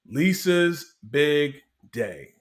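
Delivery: slow, drawn-out announcer voice; dramatic announcement. Retold or imitated. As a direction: announce it like a movie title, slowly.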